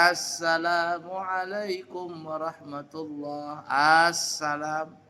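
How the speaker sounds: "Assalamualaikum" slow and stretched out; long drawn salam. Shown as a demonstration. Teacher imitating the third wrong, stretched reading. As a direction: draw out every vowel slowly. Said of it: "yang ketiga".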